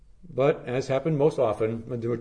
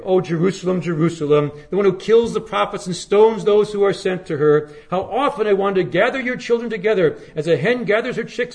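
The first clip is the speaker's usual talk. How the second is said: higher and louder mournful cry, slowing at the end; lament; 6 semitones higher, 4 dB louder than usual. Retold or imitated. Preacher voicing Jesus lamenting over Jerusalem, higher, louder, slowing at the close. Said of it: "he prayed".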